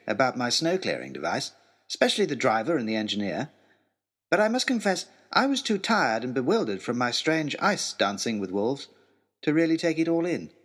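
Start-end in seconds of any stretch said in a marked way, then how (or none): none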